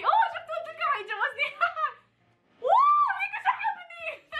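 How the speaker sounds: very high laughing whoop of delight; giddy squeal. Real, not acted. Player tells viewers he loves her, squealing giddily.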